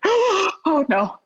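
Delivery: high gasp of dread; sudden dread, pitch jumps up; caught, he knows; panicked oh no. Retold or imitated. Sees husband calling, gasps.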